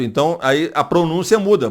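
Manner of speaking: ordinary talk, nothing marked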